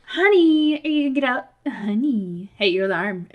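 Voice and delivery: high, loud sing-out easing off; imitated wife waking spouse. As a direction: call out like a wife rousing a sleeper. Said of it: "My wife came in and goes".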